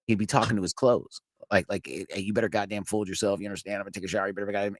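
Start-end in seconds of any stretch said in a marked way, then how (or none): 1.9-4.8 low, much softer mock drill-instructor scolding. Sergeant lecturing his clothes, imitated low and soft. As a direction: scold the clothes under your breath. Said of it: "he'd be yelling at it".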